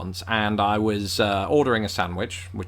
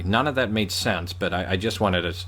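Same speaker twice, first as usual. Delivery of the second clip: ordinary talk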